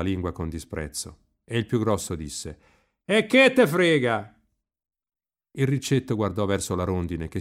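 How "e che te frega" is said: loud, high, slow yell; scornful shouted retort. A narrator's reading. Big boy answers Riccetto with scorn, loud high retort. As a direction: throw it back loudly with contempt.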